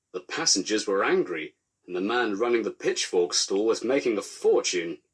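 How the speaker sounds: plain reading voice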